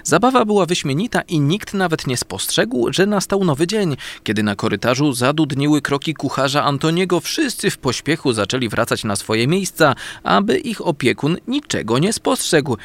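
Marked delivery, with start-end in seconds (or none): none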